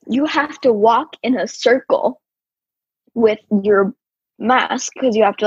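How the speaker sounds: ordinary talk, nothing marked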